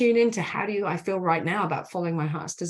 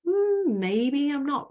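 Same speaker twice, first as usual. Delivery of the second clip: much higher, musing hmm; wondering inner part; 8 semitones higher, slower than usual. Retold or imitated. Coach voicing a part that starts to question being stuck, high and musing. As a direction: float the doubt up high. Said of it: "We're now bringing in".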